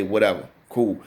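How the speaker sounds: ordinary talk, nothing marked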